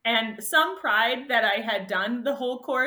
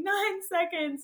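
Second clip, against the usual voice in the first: high and slow, dazed; repeating in disbelief; 9 semitones higher, slower than usual. Retold or imitated. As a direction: keep repeating it, stunned. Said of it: "I just kept repeating".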